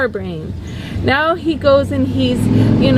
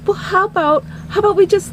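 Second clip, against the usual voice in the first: tentative pleading; 7 semitones higher, much faster than usual. Retold or imitated. Singer timidly pleading.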